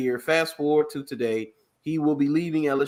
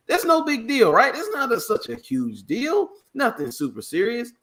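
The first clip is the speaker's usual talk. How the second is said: high mock-innocent shrug, much higher; sarcastic; 9 semitones higher than usual. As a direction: play it off with exaggerated sarcasm. Sarcastic mock dismissal of scandal.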